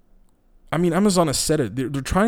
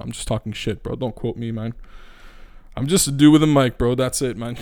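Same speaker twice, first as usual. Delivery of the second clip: ordinary talk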